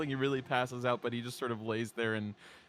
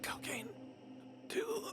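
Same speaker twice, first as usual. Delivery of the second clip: faint, strained plea; weak injured kid; 11 dB quieter, slower than usual. Acted. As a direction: barely get the words out, softly. Injured kid asks for drug.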